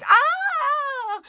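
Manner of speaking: high loud shriek; sudden yelp. Acted. Narrator imitates Penelope's cry, high shriek. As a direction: repeat the startled cry.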